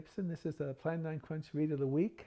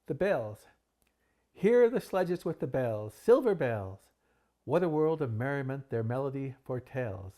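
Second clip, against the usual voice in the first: dramatic poetry reading, rising with the bells; theatrical reading of a Victorian poem; poem recital; 2 semitones higher, 7 dB louder than usual. Acted. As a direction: read it like a spooky Poe recital, building to a frenzy. Reads Poe's The Bells aloud.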